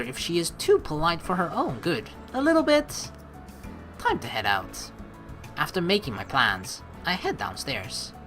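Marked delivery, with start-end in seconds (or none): none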